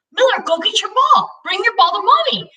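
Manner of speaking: put-on high, lilting baby-talk; imitated mom to baby. Retelling a mother sending her baby for the ball, high coaxing.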